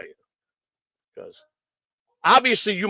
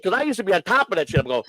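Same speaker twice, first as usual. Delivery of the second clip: usual speaking voice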